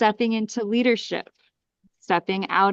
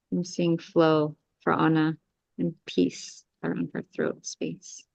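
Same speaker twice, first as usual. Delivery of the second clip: guided clearing; 3 semitones lower than usual. Acted. Healer guiding the group through an energy clearing, calm meditation voice. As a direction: ease the group through each breath and command.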